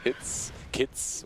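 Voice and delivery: "hits" then "kits", the h turned into a hard k. Shown as a demonstration. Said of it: "we say our words wrong".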